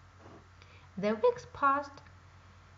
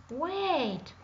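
a raised call after her; frog calling out; 5 semitones higher, 4 dB louder than usual. A narrator's reading. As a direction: call her back before she runs. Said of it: "called out the bullfrog".

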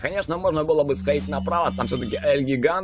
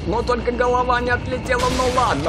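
excited gamer; 7 semitones higher than usual. Real, not acted. Cheering kills.

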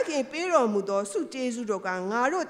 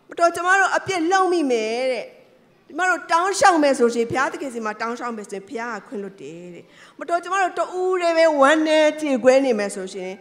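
impassioned preaching; 5 semitones higher, 7 dB louder than usual. Acted. Climactic preaching, reassuring congregation.

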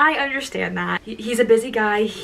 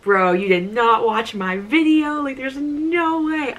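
climbing, drawn-out mock protest; emphatic disbelief; 4 semitones higher, 3 dB louder, slower than usual. Fan refusing to believe he watched her video, disbelief. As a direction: stretch it higher as it builds.

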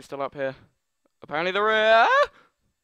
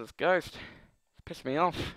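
ordinary talk, nothing marked

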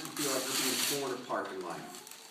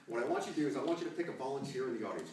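ordinary delivery

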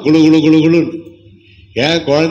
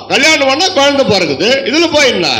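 sarcastic scoff; 10 semitones higher than usual. Retold or imitated. Preacher mimicking scoffers who belittle childless couples, high sneering. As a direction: sneer that having kids is nothing special. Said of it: "சொல்லுவாங்க சிலர் குதர்ப்பமா".

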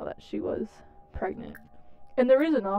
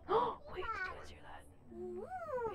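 hushed surprise; 13 semitones higher, 10 dB quieter, much slower than usual. Real, not acted. Gasps at sudden cricket sound.

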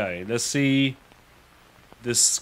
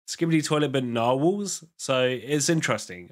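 ordinary talk

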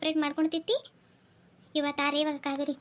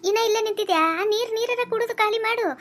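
higher, louder telling-off; scolding; 6 semitones higher, 5 dB louder than usual. Acted. Mother-in-law tells off daughter-in-law for lingering, higher and louder. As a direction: scold her for still standing there.

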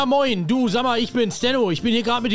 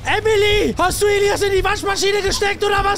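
loud, much higher shrieking panic; panicked shout; 10 semitones higher, 4 dB louder than usual. Acted. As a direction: scream in horror at the washing machine. Kind in Waschmaschine entdeckt. (panisch und schrill schreiend)

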